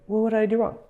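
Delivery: put-on high, defensive girl's voice; mock girlfriend. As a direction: play her, high and offended. Girlfriend answers back.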